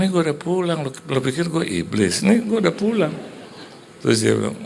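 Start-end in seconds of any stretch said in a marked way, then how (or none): 0.0-3.1 higher, louder indignant reply; annoyed retort. Retold or imitated. Husband home late answers his sleepy wife, mock annoyed retort. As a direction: protest in mock exasperation.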